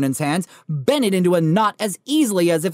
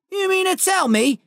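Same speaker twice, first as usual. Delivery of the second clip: growled anger; 14 semitones higher than usual. Acted. Giant growling at the Dursleys in outrage. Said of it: "he growled".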